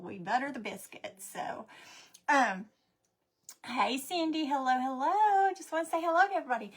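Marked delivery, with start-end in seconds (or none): none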